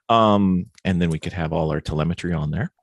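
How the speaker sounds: usual speaking voice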